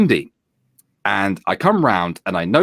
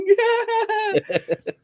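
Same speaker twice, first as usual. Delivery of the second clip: high laughter. Real, not acted. Laughs after the quote.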